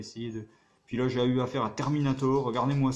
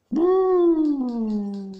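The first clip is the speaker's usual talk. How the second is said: high, loud boo at the screen; 13 semitones higher, 9 dB louder than usual. Real, not acted. Gamer boos the game after a failed jump, loud boo.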